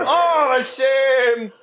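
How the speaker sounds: slow, high, theatrical lament; melodramatic. Acted. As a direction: wail it like a tragic actor. Melodramatic lament of shame damage.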